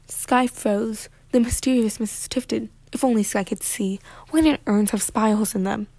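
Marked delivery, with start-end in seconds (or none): none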